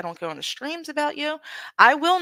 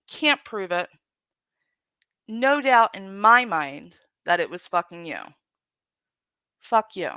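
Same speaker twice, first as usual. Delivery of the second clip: normal talking voice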